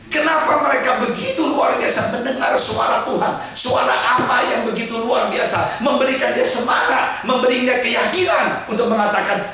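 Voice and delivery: high, loud climax of the sermon; full-force preaching. Acted. Preacher proclaims the martyrs' faith to the congregation, full force. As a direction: proclaim it at the top of your voice.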